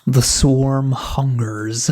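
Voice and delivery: slow, loud, eerie hive queen; swarm queen. Acted. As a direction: drag each word out like a ravenous hive mother. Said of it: "Resident, uh, Brood Master".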